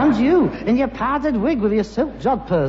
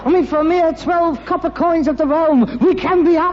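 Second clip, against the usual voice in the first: swept-up, higher and grander, savouring a dreamy escape; mock elation; 6 semitones higher than usual. Son to father, grandly mocking a final holiday. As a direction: sell the gas oven like a holiday.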